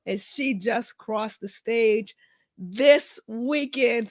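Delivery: usual speaking voice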